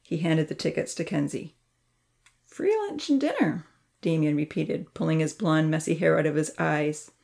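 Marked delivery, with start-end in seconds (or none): 2.4-3.6 much higher, a touch of pleased disbelief; boy surprised by free meals; pleased surprise. Acted. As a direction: repeat it like you can't believe your luck. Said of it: "a pleasant look of surprise".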